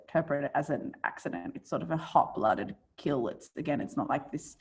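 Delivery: ordinary delivery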